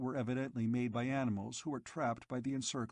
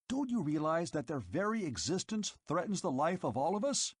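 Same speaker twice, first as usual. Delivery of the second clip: higher, rising furious tirade; exploding in anger; 6 semitones higher than usual. A narrator's reading. Eldest keeper berating the robot, exploding in anger. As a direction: blow up at the robot, pitch climbing. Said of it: "The eldest keeper exploded".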